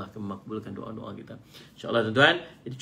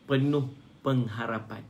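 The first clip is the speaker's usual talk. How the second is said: ordinary delivery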